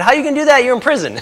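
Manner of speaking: high, loud mock disbelief; incredulous retort. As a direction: retell it as an amused, incredulous comeback. Retold incredulous comeback to prisoner.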